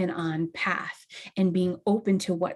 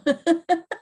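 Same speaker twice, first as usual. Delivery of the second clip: high laugh; 9 semitones higher, 4 dB louder than usual. Real, not acted. Laughs at own habit.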